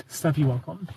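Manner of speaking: usual speaking voice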